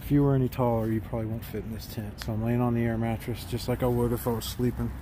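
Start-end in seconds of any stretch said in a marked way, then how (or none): none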